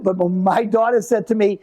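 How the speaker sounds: ordinary talk, nothing marked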